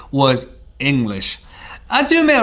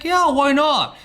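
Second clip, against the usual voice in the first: eager yes; 10 semitones higher than usual. Retold or imitated. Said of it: "I was like".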